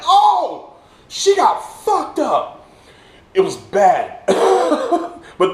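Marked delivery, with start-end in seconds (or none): 0.1-2.7 loud, high "oh", then drawn-out words; hammed-up stunned exclamation; shocked outcry. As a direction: react like you just saw a brutal hit. Acts out shock at the hit.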